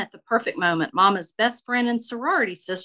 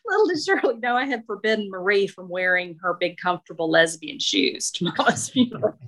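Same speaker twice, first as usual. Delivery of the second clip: ordinary delivery, nothing marked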